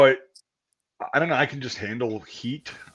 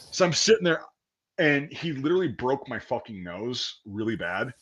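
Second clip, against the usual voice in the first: normal talking voice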